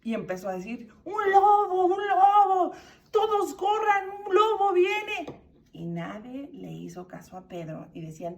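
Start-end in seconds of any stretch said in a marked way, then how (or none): 1.0-5.4 high, loud panicked shout; acting out a terrified cry for help; frightened alarm. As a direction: scream a desperate warning to the whole village. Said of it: "estaba bien asustado".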